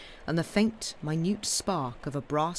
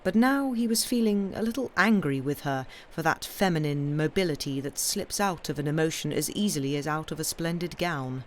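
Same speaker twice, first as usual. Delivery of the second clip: ordinary delivery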